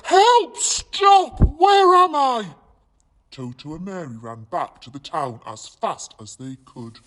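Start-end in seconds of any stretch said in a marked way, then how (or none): 0.1-2.6 high, loud panicked yells; frantic high cries; panicked crocodile cries. Acted. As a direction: squeal for help while tumbling. Crocodile tumbling, crying out.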